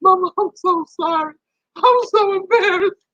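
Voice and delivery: high, squeaky mock sob; imitated crying. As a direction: blubber an apology to mama, hammed up. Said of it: "I done cried myself to sleep".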